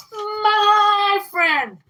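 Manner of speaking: high, loud, drawn-out imitation of the friend; mock possessive shout. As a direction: shout it like she's claiming her friend, slow. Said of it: "She literally said it".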